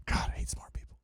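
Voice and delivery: whispered mock-exasperated aside; hushed, joking complaint to yourself; whispered grumble. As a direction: grumble it under your breath. Mock grumble after being outsmarted.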